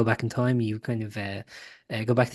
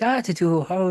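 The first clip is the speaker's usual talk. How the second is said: higher, hammed-up Irish accent; 7 semitones higher, 3 dB louder than usual. Host urging guest to overdo it, mock Irish accent. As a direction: do a caricature Irish lilt. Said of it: "go, like, really offensive with it".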